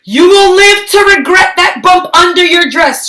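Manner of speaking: high, loud spooky warning; a child playing a prophet of doom; ominous warning. Lily warning a pregnant stranger, spooky and ominous.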